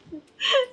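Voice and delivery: high, loud giggle; embarrassed laughing. Real, not acted. Streamer finds own cheesy line cringe, laughing. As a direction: crack up high at yourself.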